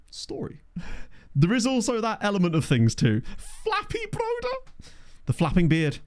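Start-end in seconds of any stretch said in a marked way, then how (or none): 0.1-0.8 whisper. Real, not acted. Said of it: "whispered aside". 3.6-4.6 high silly voice. Pretend. Silly nickname voice.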